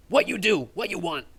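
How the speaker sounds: second goblin challenging the strangers. Acted. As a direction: bark it like a suspicious goblin. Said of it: "the other goblin says".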